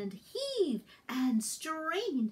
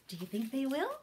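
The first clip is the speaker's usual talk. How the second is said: ordinary delivery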